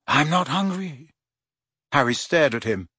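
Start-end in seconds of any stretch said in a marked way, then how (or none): 0.1-1.1 put-on slow, dreamy boy's voice; a hint of a dazed lovesick teen; lovesick Ron. A narrator's reading. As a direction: drift off mid-sentence.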